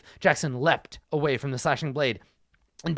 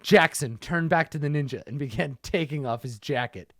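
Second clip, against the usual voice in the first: normal talking voice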